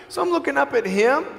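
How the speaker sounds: normal talking voice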